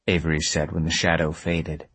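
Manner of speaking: ordinary delivery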